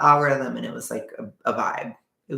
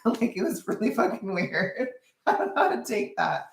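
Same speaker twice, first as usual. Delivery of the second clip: real laughter through the words; laughing; 9 semitones higher than usual. Real, not acted. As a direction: laugh off the awkwardness. Laughing at awkward memory.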